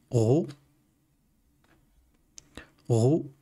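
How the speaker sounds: "Ro" said with a rounded vowel after ghayn; letter with damma. Shown as a demonstration. Teacher shows learners the damma vowel, demonstrated "Ro". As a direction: say "Ro" alone as a model syllable. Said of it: "je peux mettre une damma et prononcer".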